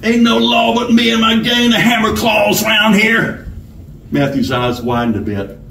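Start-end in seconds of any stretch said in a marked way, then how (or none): 0.0-3.5 higher-pitched robber character voice; bossy outlaw giving orders; bullying robber. A narrator's reading. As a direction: announce the hold-up like you own the room. Robber boasting of his gang.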